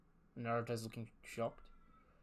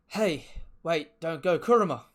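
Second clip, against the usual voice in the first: loud, high plea shouted after someone; desperate call; 5 semitones higher, 11 dB louder than usual. Acted. Naruto begging Kuruma not to go, desperate shout. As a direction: call out urgently to stop a friend leaving.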